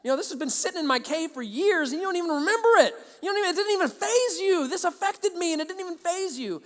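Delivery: high-pitched, incredulous protest; exasperated rant. Retold or imitated. Exasperated inner rant at bully.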